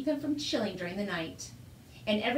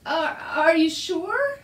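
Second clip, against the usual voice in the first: slow, loud, higher-pitched stammer; 5 semitones higher, 7 dB louder, much slower than usual. A narrator's reading. Father doubting his son about the dragon, stammering. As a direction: stumble on the first word in disbelief. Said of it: "stammered his father".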